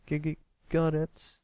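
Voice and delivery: stammering nervously. Acted. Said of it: "swallowed the lump in his throat".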